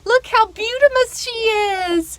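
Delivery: very high, slow doting coo; high sing-song admiration; admiring a pet. Real, not acted. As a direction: gush over the pretty hen. Doting on the hen.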